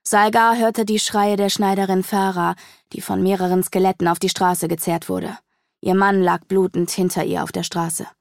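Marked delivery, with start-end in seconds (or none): none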